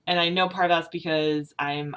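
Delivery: ordinary delivery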